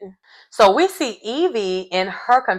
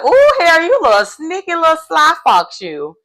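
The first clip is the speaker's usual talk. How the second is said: teasing admiration; 10 semitones higher, 4 dB louder than usual. Retold or imitated. Recapper reacting to Harry's sly move, teasing and high-pitched. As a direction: tease a sly schemer, pitched way up.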